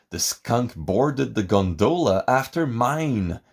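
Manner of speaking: usual speaking voice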